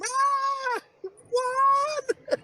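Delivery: high wailing scream of a name; mock scream. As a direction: scream it like a wife watching him vanish. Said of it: "you just hear her scream".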